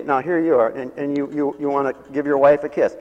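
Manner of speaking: ordinary delivery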